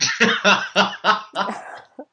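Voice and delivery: high burst of laughter; real laugh. Real, not acted. Amused by co-host's shared dick-pic problem, high laughter. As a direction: crack up in a run of high laughs.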